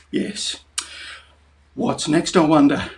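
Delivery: ordinary talk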